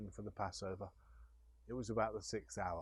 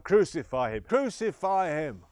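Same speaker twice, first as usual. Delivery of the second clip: angry crowd shout; 7 semitones higher, 12 dB louder than usual. Acted. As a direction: roar it like a mob. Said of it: "they shouted out".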